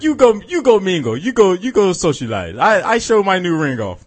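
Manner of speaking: put-on dismissive comic Russian accent; bad Russian accent. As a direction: shoo them away in mock Russian. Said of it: "That's a terrible Russian accent".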